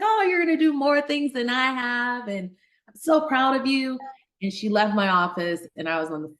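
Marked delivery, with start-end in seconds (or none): 0.0-4.0 higher, upbeat mock doctor encouragement; doctor self. Doctor cheering on her medical student, higher and upbeat. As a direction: put on the composed doctor for the student. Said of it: "I was my doctor self".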